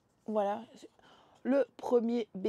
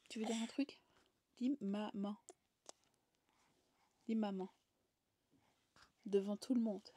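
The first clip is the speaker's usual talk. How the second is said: soft whisper; 10 dB quieter than usual. Real, not acted. Coaxing baby softly.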